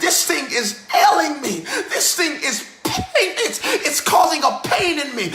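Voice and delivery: rhythmic preacher cadence, shouted peaks; full-force preaching. Acted. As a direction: preach it like an old Baptist preacher. Preaching resumes after laughing break.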